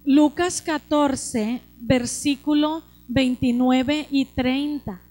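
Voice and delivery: slow scripture announcement. Acted.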